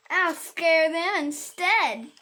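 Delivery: loud, slow, triumphant ending. A narrator's reading. Child ends the story, loud and slow. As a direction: land the final line big.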